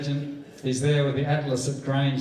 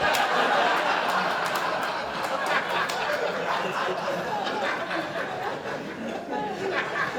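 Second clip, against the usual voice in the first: high breathy laughter at his own story; big laugh; 3 dB quieter than usual. Real, not acted. Storyteller laughing at the punchline about Jimmy, high and breathy. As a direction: let the laugh burst out long and high.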